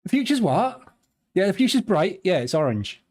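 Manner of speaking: put-on jokey West Country yokel accent; Somerset farmer accent. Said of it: "That's how farmers talk in Somerset".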